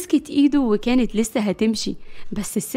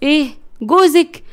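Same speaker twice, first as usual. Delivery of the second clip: taken-aback question, pitch rising; startled, raised query; shocked; 4 semitones higher, 5 dB louder than usual. Acted. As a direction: blurt it in disbelief. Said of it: "مرجانة بصدمة قالت".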